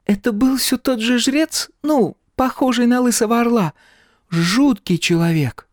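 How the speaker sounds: put-on high-pitched girl's voice; high girlish character voice. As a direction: voice a chatty girl, pitched well up. Girl character's line in narrated story.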